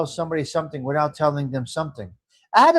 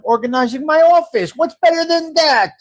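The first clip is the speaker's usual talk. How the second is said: pitched way up and louder, thrilled; bright rhetorical boast, much higher and louder; excited; 9 semitones higher, 6 dB louder than usual. Real, not acted. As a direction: sell it like the best news all day. Excited live-stream greeting.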